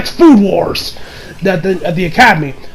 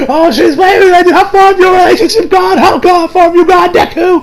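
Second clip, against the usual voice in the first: high, hammed-up interrogation; mock-outraged high yell; 10 semitones higher than usual. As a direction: imitate jealous friends yelling accusations, pitched way up. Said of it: "as they keep yelling".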